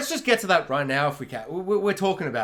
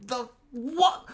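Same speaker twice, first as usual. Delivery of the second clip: hushed, higher, halting shock; stunned disbelief; 4 semitones higher, 5 dB quieter than usual. Retold or imitated. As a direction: stammer it softly, rising into disbelief. Retells own stunned reaction to title.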